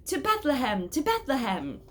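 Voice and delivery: loud, high call to set off; rallying call. A narrator's reading. Shepherd striking his crook to stop a quarrel, loud rallying call. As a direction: rouse the pilgrims onward. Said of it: "struck the cobblestones with his crook and said".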